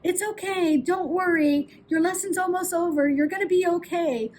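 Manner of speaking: mock-anxious comfort from the poolside; anxious parent reassurance. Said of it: "the tone of voice that we use".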